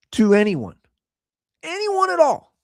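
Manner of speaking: ordinary talk, nothing marked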